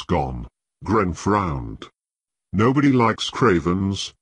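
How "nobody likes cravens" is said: usual speaking voice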